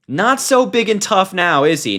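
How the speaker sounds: high, mocking sing-song taunt; sing-song mockery. Real, not acted. Taunting mugshot.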